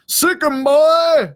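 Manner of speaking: high, loud sic 'em holler; teasing cowboy call. Retold or imitated. Second cowboy egging the dog on, loud and mocking. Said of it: "said the other".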